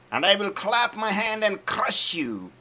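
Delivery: high, loud, fierce threat; David roaring defiance at Goliath. Acted. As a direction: shout the threat right at the giant.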